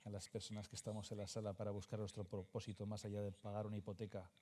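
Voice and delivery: usual speaking voice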